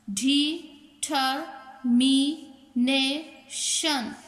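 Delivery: determination in five drawn-out beats, de, ter, mi, na, tion; teacher sounding out de—ter—mi—na—tion; split into syllables. Shown as a demonstration. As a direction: say determination very slowly with a gap after every syllable. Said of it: "चार vowel से ज़्यादा की sound".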